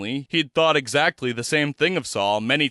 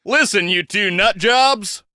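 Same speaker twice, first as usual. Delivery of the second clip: slow, higher, louder shout to cut through chatter; 8 semitones higher, 4 dB louder than usual. Acted. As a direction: yell over them to make them stop. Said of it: "Randall found himself shouting".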